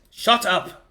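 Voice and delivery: mock-annoyed outburst. Real, not acted. Game master teased about a slipped accent, mock-annoyed retort. Snaps back after accent slip.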